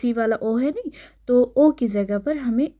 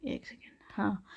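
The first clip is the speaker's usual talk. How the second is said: whispered aside; 17 dB quieter than usual. Real, not acted. Aside while pausing.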